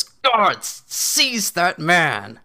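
high, loud, slow barked order; furious command. Acted. Baron ordering his guards to seize the laugher, furious shout. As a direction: roar at the guards to grab him. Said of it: "And he goes".

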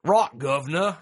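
British accent attempt. Shown as a demonstration. Host showing the co-host his best British, hammy mock Cockney. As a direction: throw out a cheesy British catchphrase. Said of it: "That's the best I can do".

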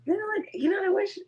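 high, fast excited gush. Real, not acted. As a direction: gush over something you love. Excited describing cool socks.

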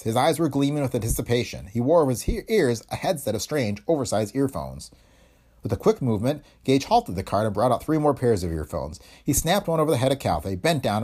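plain reading voice

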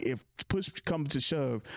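ordinary delivery

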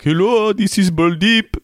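put-on high, sing-song Indian accent; imitated Indian accent. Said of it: "expecting him to be like".

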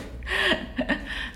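high breathy burst of laughter. Real, not acted. Laughing at the joke.

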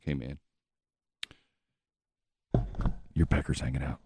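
low, hushed aside, man to man; hushed tip-off. Retold or imitated. Telling a stranger his fly is open, hushed. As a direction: lean in and tell him quietly.

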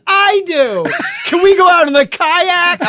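put-on high, loud kid-show enthusiasm; imitated TV-kid son. Dad imitating his son begging for the kayak, hammed-up kid voice. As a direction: overact it like a child on a sitcom. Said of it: "who talks like that?".

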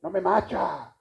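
"macha" said with a put-on voice, like English match; mock Spanglish. Shown as a demonstration. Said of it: "No se dice". (imitando el spanglish "no me macha")

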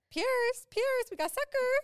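high, loud, drawn-out mock call. Mom luring runaway child with candy, calling out. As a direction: sing out to lure a child back.

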